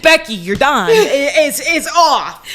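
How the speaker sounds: high, loud, stumbling insistence; half-laughing hammed-up yell; retold frantic order. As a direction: shout it down the phone in mock exasperation. Acting out yelling at teller.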